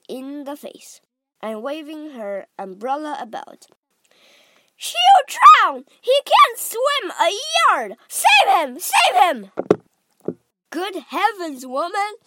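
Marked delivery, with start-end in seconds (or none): 4.8-9.5 high, loud panicked shrieks; mother frantic for her drowning son; screaming. A narrator's reading. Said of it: "screamed Missus Gloop". 10.7-12.2 high-pitched husbandly protest; indignant at his wife; exasperated. A narrator's reading. Husband snapping at panicking wife.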